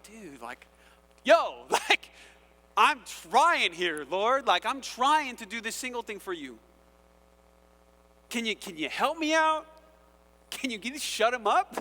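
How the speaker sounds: half-laughing, pleading for help; frustrated single guy. Acted. A frustrated single believer begging God, exasperated. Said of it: "and you're like".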